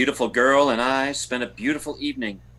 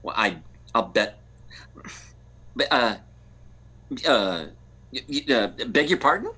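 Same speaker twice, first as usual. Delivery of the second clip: low, halting stammer of shock; flustered; 9 semitones lower than usual. Acted. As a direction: stumble for words, dumbfounded. Stunned that husband doesn't know.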